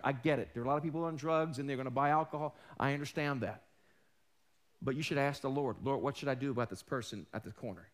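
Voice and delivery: ordinary talk, nothing marked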